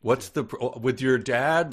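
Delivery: loud, high yelling over noise; shouting over music. Retold or imitated. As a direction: yell over a loud club.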